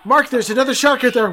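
normal talking voice

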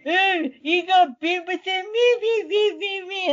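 high mocking camp-leader voice; dickhead impression. Camp leader answering a snakebitten kid, mocking high babble. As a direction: mimic him in a silly high-pitched babble. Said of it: "That's basically what he sounds like".

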